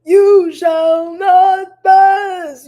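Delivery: high, loud, very slow booming wizard line; Gandalf impression. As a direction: boom it out like Gandalf blocking the bridge. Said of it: "And like Gandalf".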